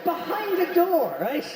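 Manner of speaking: ordinary talk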